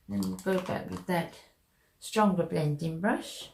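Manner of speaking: usual speaking voice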